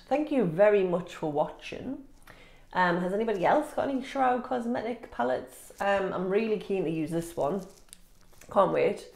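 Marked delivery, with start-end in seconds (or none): none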